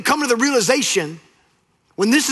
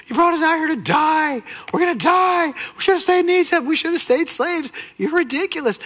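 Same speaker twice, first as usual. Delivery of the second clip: panicked crying out; 8 semitones higher than usual. Acted. Israelites crying out to Moses at the sea, panicked high shout. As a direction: cry out in panic that you'll die. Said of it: "They cry out to Moses".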